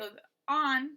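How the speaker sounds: "on" drawn out slow and loud; stressed ON. Shown as a demonstration. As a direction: lean hard and long on "on". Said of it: "that first syllable is stressed".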